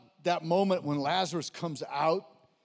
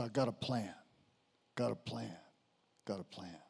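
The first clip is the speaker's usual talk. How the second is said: fading to hush; 9 semitones lower, 11 dB quieter than usual. Acted. Repeats phrase fading away.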